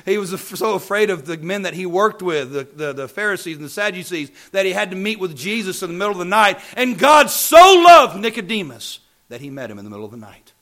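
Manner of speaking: loud, high build to a shouted refrain; full-force preaching. Real, not acted. Preacher telling how Nicodemus came by night, full-force preaching. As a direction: drive up to a roaring "so loved".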